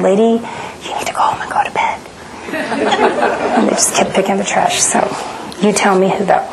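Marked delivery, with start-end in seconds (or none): 0.0-2.0 half-whispered, brisk advice from the stewardess; breathy quick whisper, telling her to go home; hushed stewardess. Retold or imitated. Stewardess quietly telling the passenger to go home, whispered. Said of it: "She said". 2.4-3.5 high breathy burst of laughter; airy laugh. Real, not acted. Speaker laughing at the stewardess's odd advice, airy laugh.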